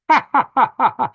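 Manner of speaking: high burst of laughter; loud laugh. Real, not acted. Laughing after teasing Larry about being a troll, loud cackle. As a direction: cackle loudly.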